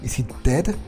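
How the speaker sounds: ordinary talk, nothing marked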